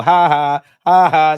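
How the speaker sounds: big real laugh, ha ha ha; loud laugh. Real, not acted. Laughing at his own captain pick, loud real laugh. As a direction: burst out laughing at himself.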